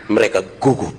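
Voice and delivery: usual speaking voice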